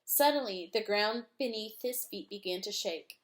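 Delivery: usual speaking voice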